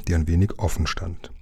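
ordinary delivery

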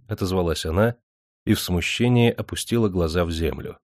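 usual speaking voice

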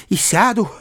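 high-pitched reckless defiance; girl's defiant cry. A narrator's reading. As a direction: throw it back in a high child's voice. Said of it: "кричала Сашенька".

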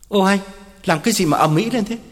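quick, high-pitched stammered pleading; flustered protest. Acted. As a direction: hurriedly try to hush an angry lover. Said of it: "ú ớ phân trần". (phân trần cuống quýt, nói nhanh)

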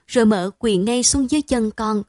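ordinary delivery

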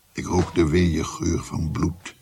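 usual speaking voice

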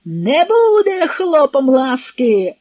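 high, loud, drawn-out scream; shrieking outburst; hysterical shout. A narrator's reading. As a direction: cry out in hysterical refusal. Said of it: "— істерично викрикнув Потоцький".

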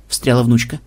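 plain reading voice

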